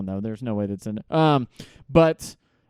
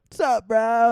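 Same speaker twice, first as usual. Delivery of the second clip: surfer dude voice; 7 semitones higher than usual. Retold or imitated. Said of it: "they're always like".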